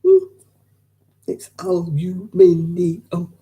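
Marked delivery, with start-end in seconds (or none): 1.3-3.3 very slow aluminium, every syllable drawn out; British aluminium. Shown as a demonstration. Insisting on the British aluminium to the viewers. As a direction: stretch out aluminium, al-u-MIN-i-um. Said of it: "repeats correct form".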